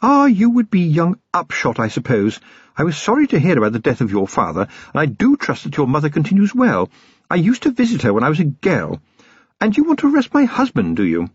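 high-pitched lady of the house, politely imperious; swooping high voice of a self-assured wife; gracious, formidable lady. A narrator's reading. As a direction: social niceties that build to a challenge.

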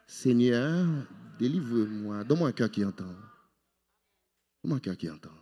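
low, soft, pleading prayer; hushed prayer. Shown as a demonstration. Preacher leads the congregation in prayer, hushed and low.

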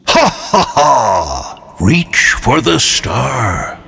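slow booming giant laugh; giant alien booming voice. Acted. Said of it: "deep voice boomed".

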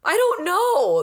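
hurt person shrugging off a finger injury, high and slow; baffled answer. Pretend. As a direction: stretch it out, helplessly puzzled.

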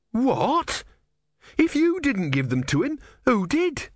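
high-pitched, outraged demand; indignant. A narrator's reading. Disciple challenging his god, high-pitched indignation.

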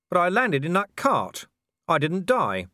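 high, insistent objection; protesting. Acted. Vimes objects to Death in the boat, high protest.

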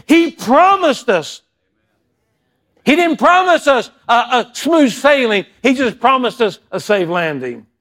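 loud, high preacher's climax, easing down at the end; full-force preaching. Acted. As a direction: drive the promise home to the congregation.

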